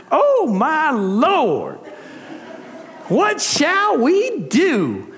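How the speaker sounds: hammed-up Southern twang, drawn out; southern accent. Shown as a demonstration. Pastor jokingly rereads the servant's cry, mock Southern accent. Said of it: "read that one with a southern accident, accent".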